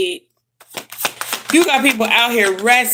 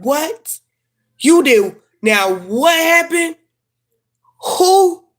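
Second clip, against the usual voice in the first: rising higher and louder, stunned and nosy; shocked gossip questions; 6 semitones higher, 4 dB louder, much slower than usual. Retold or imitated. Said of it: "Like, you said".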